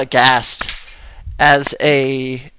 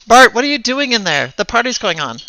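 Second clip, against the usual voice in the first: annoyed mom; 8 semitones higher than usual. Acted. GM voices the mom.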